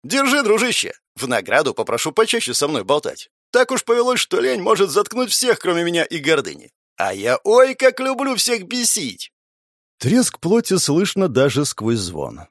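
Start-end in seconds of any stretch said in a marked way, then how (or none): 0.0-9.3 put-on high, playful teenage boy; mischievous teen voice. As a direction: tease like a cheeky teenager.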